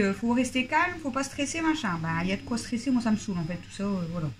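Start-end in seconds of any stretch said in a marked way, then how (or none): 0.1-2.0 mocking singsong. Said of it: "Après, on me dit".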